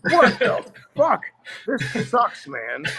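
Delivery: put-on a fifteen-year-old crushed by his present; teen disgust. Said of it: "I'm like".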